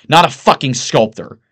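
loud, exasperated outburst; crying out. A narrator's reading. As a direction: hammer the correction home loudly. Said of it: "Price cries out".

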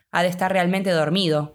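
plain reading voice

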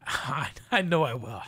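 real tearful catch in the voice; choked up. Real, not acted. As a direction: push the words out through tears. Overcome speaking of late mother.

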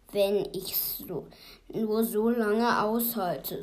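loud, drawn-out mock sigh. A narrator's reading. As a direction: sigh the words out slowly. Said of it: "seufzte Grabsch". (langsam geseufzt)

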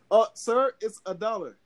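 a clerk politely stopping a customer; worker's voice. Retold or imitated. Voicing the worker stopping him.

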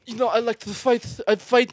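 high-pitched boxer impression; Mike Tyson. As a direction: do a high-voiced boxer for the guesser. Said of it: "Oh, Mike Tyson".

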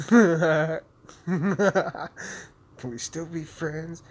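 high, slow, whiny plea; drawn-out mock pleading friends. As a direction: beg it like a needy ex.

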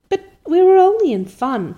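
persisting. A narrator's reading. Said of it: "persisted Anne".

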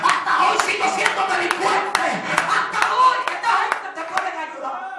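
loud, driving preacher's cry over music; preaching at full force. Acted. Preacher shouting to the congregation about surrender to God. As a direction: hammer each hasta hoy at the congregation.

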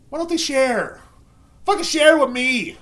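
high, loud joking demand; mock outraged shout. Joking that the family should share, mock outraged shout. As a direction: whine it at full volume as a joke. Joking complaint, then "just kidding.

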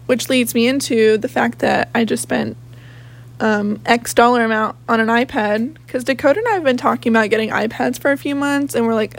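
ordinary talk, nothing marked

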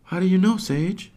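put-on high, curious child voice; little boy asking. Hops questioning his sister, high and curious. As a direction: challenge your sister. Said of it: "Hops asks".

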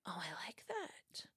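slow, hushed relish; intrigued whisper. Retold or imitated. Youngster discovering a different spiritual view, intrigued whisper. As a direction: whisper it like a guilty secret.

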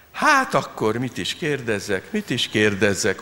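magasabban, hangosabban, lassan tréfálkozó; évődj a diákkal, lassan elnyújtva; tréfálkozó tanár. Acted. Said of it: "humorizált az osztályfőnök". (lassú, tréfás tanári évődés)

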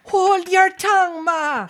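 put-on young crab snapping back at her mother, Jamaican; snappish Jamaican crab. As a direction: snap at your mother in a Jamaican accent. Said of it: "a little Jamaican crab family".

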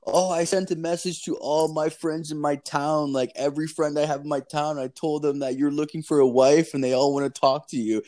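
mock-proud report from an obliging caller; eager scam caller. Voices the scammer's excited report.

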